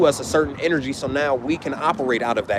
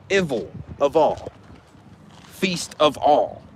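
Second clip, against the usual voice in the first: word-by-word demo; slower than usual. Shown as a demonstration. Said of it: "I need y'all to say that".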